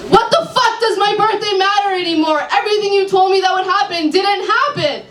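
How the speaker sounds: high, laughing outburst; exasperated client. Acted. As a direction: vent at the psychic. Client angry at psychic.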